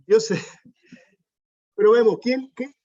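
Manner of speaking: sudden high, loud surprise; startled outburst. Real, not acted. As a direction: caught off guard, asks who spoke. Reacts to interruption in room. (sorpresa repentina, más aguda y fuerte)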